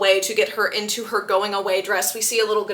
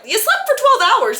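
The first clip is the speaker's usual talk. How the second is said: much higher, louder disbelief; astonished Edward; 12 semitones higher, 5 dB louder than usual. Retold or imitated. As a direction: hit it like you can't believe it. Edward stunned at her sleep.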